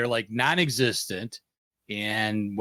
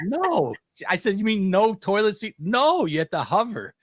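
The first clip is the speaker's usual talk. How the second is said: high, emphatic mock argument; playful imitation of insistent bickering; retold exasperated exchange; 10 semitones higher than usual. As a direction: act out a disbelieving back-and-forth, pitched up. Retold back-and-forth with his wife.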